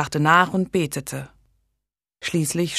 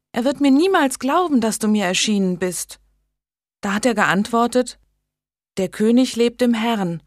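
a lightly voiced young woman, higher, telling of a vision; earnest young lady; 6 semitones higher, 4 dB louder than usual. Megan retells the vision dialogue.

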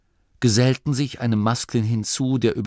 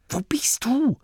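very high, hissed call; zischend. A narrator's reading. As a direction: hiss the question urgently. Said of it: "zischte Grimma". (hohes, gezischtes Rufen)

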